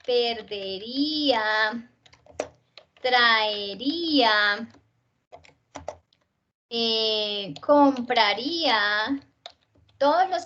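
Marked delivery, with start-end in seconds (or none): 0.1-4.7 perdería, traería said slowly with a long stressed í-a; hiato drawn out. Shown as a demonstration. Teacher giving examples of hiatus, perdería, traería slowly drawn out. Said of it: "todos son hiatos". 7.6-9.2 compraría slowed, long stressed í before the a; hiato drawn out. Shown as a demonstration. Teacher giving a conditional example, compraría with a drawn-out hiatus. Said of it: "todos son hiatos".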